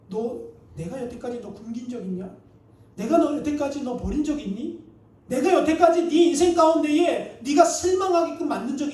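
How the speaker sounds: retold rebuke, building to full volume. God rebukes the complaining pastor.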